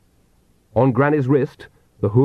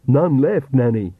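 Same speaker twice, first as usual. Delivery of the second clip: Igor voice; 3 semitones higher, slower than usual. Acted.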